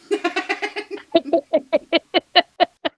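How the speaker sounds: long, high, loud burst of laughter; really cracking up. Real, not acted. Laughing at story punchline.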